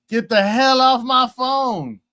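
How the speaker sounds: drawn-out mock yell; radio host catchphrase. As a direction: belt out the sign-off. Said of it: "he always says".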